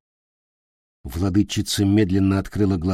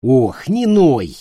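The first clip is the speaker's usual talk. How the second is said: irritated witch; 4 semitones higher, 5 dB louder, much slower than usual. A narrator's reading. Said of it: "ответила ведьма".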